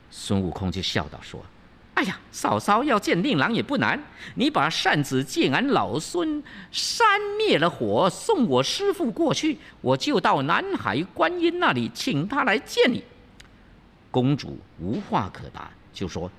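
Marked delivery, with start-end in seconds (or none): 2.0-13.0 high, sly monkey bargaining sweetly; high playful monkey offering a deal; coaxing Wukong. Acted. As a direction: talk her round with a grin.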